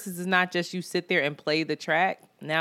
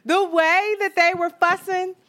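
incredulous; 10 semitones higher, 4 dB louder, slower than usual. Real, not acted. Disbelief at fussing grown men.

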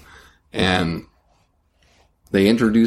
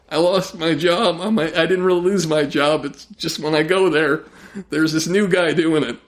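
high, jittery comic voice; nervous high-voiced oddball; twitchy high-pitched Bobcat bit; Bobcat's voice; 8 semitones higher than usual. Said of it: "channeling through me now".

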